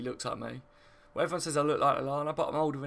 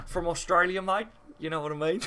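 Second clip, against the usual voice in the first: lightly put-on Australian, higher and louder with rising ends; mock Australian accent; 4 semitones higher, 4 dB louder than usual. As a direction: play at being Australian for a joke. Joking false answer about origin, then laughs.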